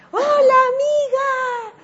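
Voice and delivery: high, loud, drawn-out greeting across the street; calling out. Retold or imitated. Man greeting the speaker from a distance, called out loud. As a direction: call out slowly to a friend far away.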